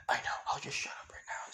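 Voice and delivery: soft whispered retreat; embarrassed whisper. Acted. Izuku told he said something dumb, sheepish whisper.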